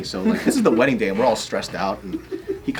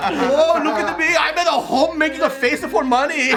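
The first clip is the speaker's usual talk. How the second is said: high, drawn-out sing-song mockery; mocking taunt; 4 dB louder than usual. Parent's imagined taunt at the YouTuber son, mocking. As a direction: jeer slowly in a silly high voice. Mocks a YouTuber's easy life.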